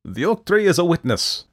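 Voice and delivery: loud, high proclamation; declaring. A narrator's reading. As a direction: declare it grandly. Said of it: "Jack declared".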